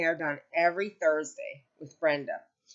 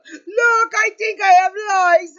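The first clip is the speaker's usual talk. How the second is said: much higher and louder, whining; whiny insistent mother; 14 semitones higher, 13 dB louder, slower than usual. Retold or imitated. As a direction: whine it like the itchy old mother. Mother voiced, insisting on lice.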